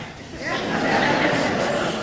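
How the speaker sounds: airy laugh caught out; embarrassed laugh. Real, not acted. Pastor caught by his wife's look, airy laugh. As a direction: laugh as if put on the spot. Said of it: "She's looking at me right now".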